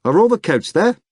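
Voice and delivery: high, loud anxious call; shouting. A narrator's reading. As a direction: call out across the lobby. Professor calling out in lobby.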